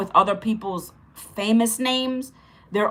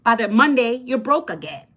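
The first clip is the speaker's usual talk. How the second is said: ordinary talk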